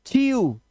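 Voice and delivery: चीयू ending on a held "oo"; EW as long "oo". Shown as a demonstration. Teacher demonstrating the rule, long "oo" at the end of चीयू. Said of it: "ईडब्ल्यू का उच्चारण आपको ऊ करना है".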